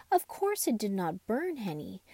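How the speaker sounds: ordinary delivery, nothing marked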